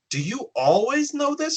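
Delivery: high, amazed question. Retold or imitated. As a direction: marvel at the cat. Asking the talking cat, amazed.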